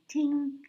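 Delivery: high, bright little ting sound effect; mimicked ting. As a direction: make a cartoon ting. Imitating a magic cut sound.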